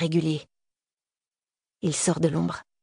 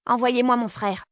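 higher-pitched, insistent demand; firm demand; 6 semitones higher than usual. A narrator's reading. Girl demanding her brother from the gunman, insistent.

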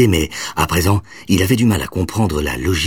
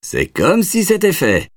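breezy promise; 13 semitones higher, slower than usual. A narrator's reading. Uncle cheerfully agrees.

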